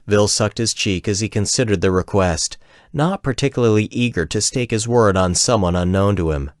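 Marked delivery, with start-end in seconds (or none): none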